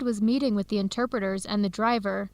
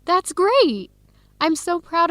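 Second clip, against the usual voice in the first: excited praise; 7 semitones higher, 4 dB louder, slower than usual. Acted. Friend congratulating on big news.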